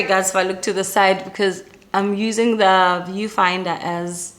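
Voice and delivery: ordinary talk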